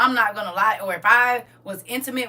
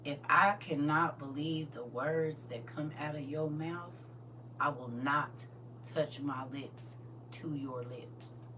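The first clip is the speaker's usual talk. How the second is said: much softer and lower, slowing on the end; low quiet warning; 8 semitones lower, 12 dB quieter, slower than usual. Real, not acted. Telling a man she won't kiss a liar, soft and low. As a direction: drop your voice and mean it.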